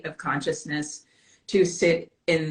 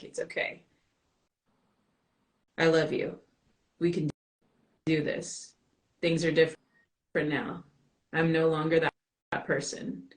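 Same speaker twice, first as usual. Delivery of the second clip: gentle encouragement; 4 dB quieter than usual. Retold or imitated. Encouraging her inner child.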